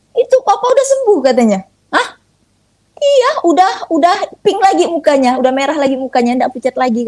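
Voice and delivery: sister calling about dad's recovery, overjoyed; excited news. Retold or imitated. Sister phones with startling good news.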